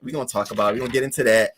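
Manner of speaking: ordinary delivery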